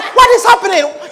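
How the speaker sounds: high, urgent, loud asking who died; alarmed questions. Acted. Bystander rushing in to ask who died, loud and alarmed. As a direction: burst in asking what's wrong.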